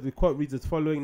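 usual speaking voice

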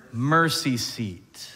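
"mercy seat" drawn out very slowly; stress on mercy seat. Shown as a demonstration. Preacher driving home God's mercy, slow emphasis on "mercy seat". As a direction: lean hard on "mercy seat". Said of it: "You're gonna hear me say this a lot".

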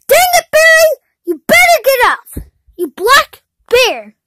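high angry shout to wake someone; yelling. Acted. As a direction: bellow at the sleeping bear. Toad yelling to wake bear.

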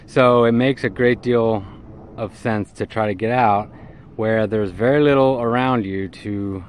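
normal talking voice

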